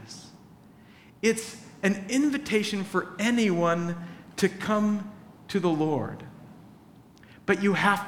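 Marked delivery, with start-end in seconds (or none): none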